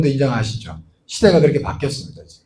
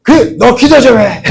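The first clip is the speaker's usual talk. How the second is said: loud, higher, slow command; boss's order; 8 semitones higher, 7 dB louder, slower than usual. Retold or imitated. Boss ordering a prayer at dinner, loud and slow. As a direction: boom it out slowly.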